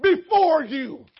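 high-powered pulpit shout; forceful preaching. Real, not acted. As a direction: drive it home to the congregation.

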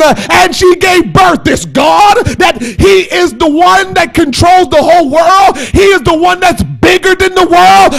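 loud, high preaching shout with drawn-out endings; full-force preaching. Real, not acted. Preacher proclaims to the congregation how great God is, full-force preaching.

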